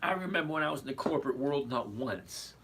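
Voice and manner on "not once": ordinary talk, nothing marked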